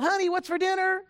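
half-hearted yell into the house. Retold or imitated. Husband calling to his wife from the front door, mock yell. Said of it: "he kinda yelled".

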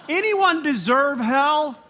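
loud, high, slow challenge to the congregation; calling out. Real, not acted. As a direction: throw the question out to the whole room. Calls out to the congregation.

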